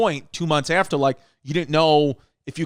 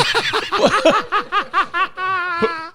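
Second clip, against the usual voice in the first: a sudden high cackle at the absurdity; high burst of laughter; 14 semitones higher than usual. Real, not acted. Laughing at the funeral director's wreath offer, high cackle. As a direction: let out a high, helpless laugh.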